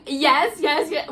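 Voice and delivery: loud, high, excited outburst; freaking out. Retold or imitated. Couple answering the front desk lady, freaking out with excitement. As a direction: re-enact an eager, overexcited yes. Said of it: "We start freaking out".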